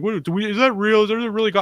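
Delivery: put-on a touch of an eager little kid; kids asking. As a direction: play the kids half believing it. Kids asking if goblins real.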